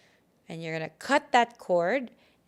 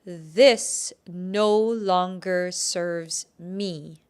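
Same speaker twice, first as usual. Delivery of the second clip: very slow, loud affirmation; slow declaration; 7 dB louder, much slower than usual. Shown as a demonstration. Healer has listeners face what holds them back, slow loud declaration. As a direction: declare each word, drawn out and louder. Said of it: "look at it and say".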